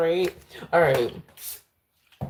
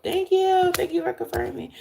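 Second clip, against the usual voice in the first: thanks sung out much higher; bright high thanks; high thank-you. Real, not acted. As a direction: lift it up high and grateful. Thanking viewers.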